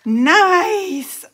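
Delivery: high, loud, delighted exclamation; real delight. Real, not acted. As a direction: cheer for a wonderful gift. Delighted by gift items.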